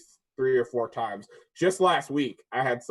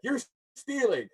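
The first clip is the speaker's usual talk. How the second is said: much higher imitated accusation; mock accusing lady; 10 semitones higher than usual. Store lady accusing the worker, mocked high. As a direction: pitch it way up like the lady. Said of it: "She's like".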